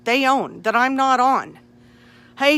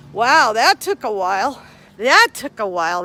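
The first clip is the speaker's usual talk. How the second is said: usual speaking voice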